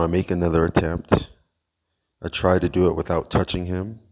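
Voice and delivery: ordinary delivery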